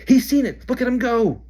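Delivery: high and loud, thrilled; excited shout. Acted. Harry shouting as the seeker dives, thrilled. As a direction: cheer him on at full voice. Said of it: "Harry shouted".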